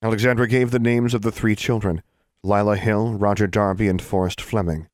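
usual speaking voice

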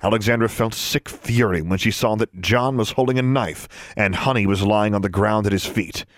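usual speaking voice

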